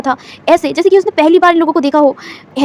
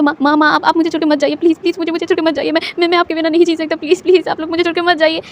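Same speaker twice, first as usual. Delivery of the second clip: tearful pleading; 3 semitones higher than usual. A narrator's reading. Wing hugs his mother, crying and begging her not to leave. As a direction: beg through tears. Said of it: "वो लगातार रोने लग गया था".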